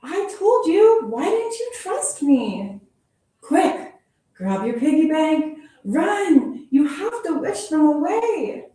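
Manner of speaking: high, loud and hurried; frantic high warning; urgent panic. Acted. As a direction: get them moving now.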